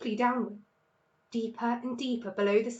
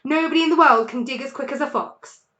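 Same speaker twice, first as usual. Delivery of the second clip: proud shout; 3 semitones higher, 9 dB louder, faster than usual. A narrator's reading. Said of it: "shouted Mister Fox".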